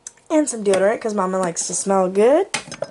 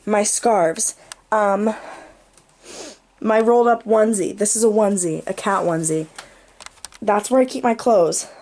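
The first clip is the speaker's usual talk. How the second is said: ordinary talk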